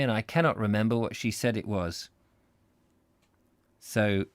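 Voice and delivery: ordinary delivery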